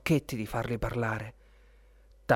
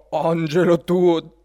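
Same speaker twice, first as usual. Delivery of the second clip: slow, louder scoffing echo; Papageno's mocking thought; 4 semitones higher, 8 dB louder, much slower than usual. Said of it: "pensò Papageno".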